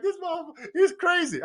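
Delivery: high-pitched imitation of an incredulous man; mock disbelief. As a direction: jump well up in pitch, as if amazed. Retold stranger's amazed reaction.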